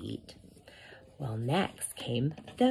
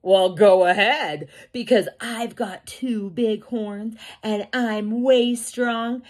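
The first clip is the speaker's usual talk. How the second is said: bold, raised goat voice; big billy goat; 7 semitones higher, 4 dB louder than usual. A narrator's reading. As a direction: defy the troll with a boastful challenge. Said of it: "And the big billy goat goes".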